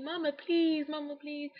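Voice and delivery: put-on high, whiny kid begging; mock childish pleading, high pitched. Begging her mom for the curling iron, whiny and high. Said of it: "I was, like, begging and pleading".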